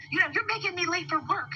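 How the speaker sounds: high-pitched mimicry of a man yelling; imitated angry complaint. Quoting the other driver to an officer, imitated angry complaint. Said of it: "he was yelling at me".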